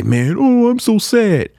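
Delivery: high, whiny mock sadness; mock depressed. Host imitating a depressed friend, mock sad. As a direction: wail it in hammed-up self-pity. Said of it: "So if somebody's depressed".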